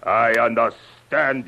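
firm, full-voiced accusing tone; hard, open contempt; scornful accusation. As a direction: drop the pretence and accuse. Villain turns to open accusation.

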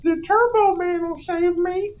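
put-on very high squeaky kid voice; defiant kid. Kid refusing Sinbad, squeaky high defiance. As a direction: squeak back like a stubborn little boy. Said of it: "And he's like".

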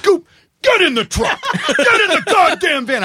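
high, laughing yell; yelling orders. Retold or imitated. Ordering the drunk friend into the van, high laughing yell. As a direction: bark it at him, half laughing. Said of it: "like a dog".

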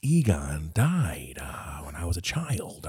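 slow, whispered sob-story confession; mock whisper. Host telling a mock childhood sob story, slow stage whisper. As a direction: whisper it slowly, hamming it up.

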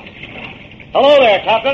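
slow, loud, high hail across the dock; calling out. Acted. Detective hailing the old captain on the dock, loud call. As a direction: call out to him from a distance.

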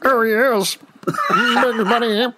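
high cartoon alien voice; Martian from Bugs Bunny. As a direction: put on a high-pitched little Martian. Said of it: "the Martian from Bugs Bunny".

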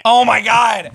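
loud, high burst of realization; surprised shout. Real, not acted. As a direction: blurt it out as it hits you. Suddenly remembers sixties Penguin.

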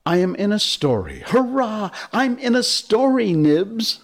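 put-on very high, exultant child voice; overjoyed. A narrator's reading. Toodles telling Nibs he is in the story, overjoyed. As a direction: cheer with pitch soaring.

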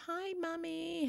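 put-on high, soft, slow little-girl voice; small daughter's voice. Little daughter greeting her mum on the phone, high and soft. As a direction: go high and small like a young child. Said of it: "she's like".

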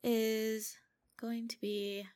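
ordinary delivery

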